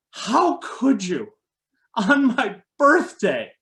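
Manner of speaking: imitating a wounded mother, high and slow; hurt mom. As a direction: drag out a high, tearful reproach. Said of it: "his mom's got tears streaming down".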